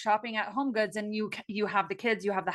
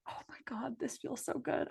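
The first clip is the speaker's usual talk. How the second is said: hushed whisper, much softer; whispered awe; 4 semitones higher, 10 dB quieter than usual. Retold or imitated. Coach acting out a private joyful moment, whispered. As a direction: whisper it as if savouring a secret moment. Voicing a quiet moment of joy.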